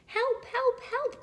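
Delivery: high-pitched cry for help. A narrator's reading. Stuck Little Blue Truck calls for rescue, crying out. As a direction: call out in a squeaky little voice. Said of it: "cried the Little Blue Truck".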